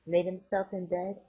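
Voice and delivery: usual speaking voice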